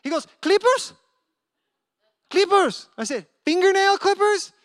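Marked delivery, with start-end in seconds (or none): 0.4-2.9 high, loud insistent "Clippers", said "kleepers"; imitated drunk man. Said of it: "drunk guy comes up". 3.4-4.5 high, loud puzzled check; acted bafflement, pitched way up; incredulous question. Checking what drunk man means.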